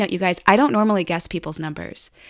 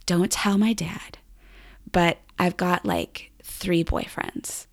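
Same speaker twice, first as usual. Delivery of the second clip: secret confession; 5 dB quieter, slower than usual. Retold or imitated. Girl confides a secret.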